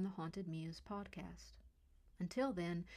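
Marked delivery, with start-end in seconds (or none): none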